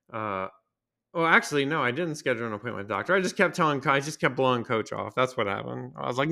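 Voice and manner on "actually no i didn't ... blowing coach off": ordinary talk, nothing marked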